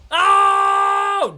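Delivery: long, high, very loud "oh"; drawn-out shout of alarm; alarmed yell. Real, not acted. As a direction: wail loudly as it goes wrong. Fight goes wrong, player cries out.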